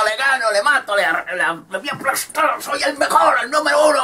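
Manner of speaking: high, bragging boxer yelling; cocky high boasting, laughing at the end. Acted. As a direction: brag like a champ pumping himself up. Boastful boxer shouting before fight.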